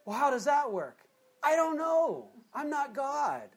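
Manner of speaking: high-pitched, exclaimed protest of ignorance; exaggerated high-voiced cluelessness; mock bafflement. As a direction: throw up the question and answer in a high voice. Acts out baffled question and answer.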